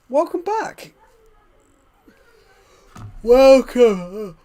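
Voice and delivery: high, loud sing-song greeting; loud welcome. Real, not acted. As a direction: call out the welcome brightly. Greeting newcomer loudly.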